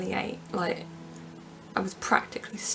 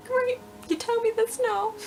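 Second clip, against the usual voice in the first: high, cracking mock-sarcastic retort; a wry, near-crying jab; sarcastic tearful retort; 13 semitones higher than usual. Sarcastically answering mum at airport security, high and cracking.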